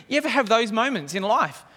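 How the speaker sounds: ordinary delivery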